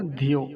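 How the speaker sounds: धियो said quickly with a short i. Shown as a demonstration. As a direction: keep धि brief in धियो. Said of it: "ह्रस्व इकार". (धियो में छोटी इ, शीघ्रता से)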